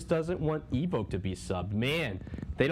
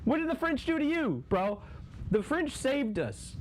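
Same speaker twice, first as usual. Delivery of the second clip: mock outrage. Mock outrage at French hater.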